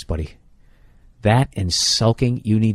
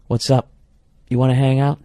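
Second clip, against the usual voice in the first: higher, put-on girl's voice; girlfriend voice; 6 semitones higher, 3 dB louder than usual. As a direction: ask it like the girl on the phone. Acted phone call with the girl.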